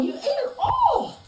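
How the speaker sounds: mock-shocked, high; teasing pet talk. Real, not acted. Teasing the pet after it ate.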